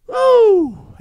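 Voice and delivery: high, loud "woo"; whooped high and long; excited whoop. Real, not acted. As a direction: let out an exhilarated whoop. Excited preacher's exclamation.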